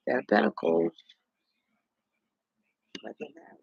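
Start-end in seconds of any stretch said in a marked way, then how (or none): 3.0-3.6 unvoiced, hushed aside; whisper. Real, not acted. Reading the tarot spread, hushing the result as a whisper. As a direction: breathe it as a secret.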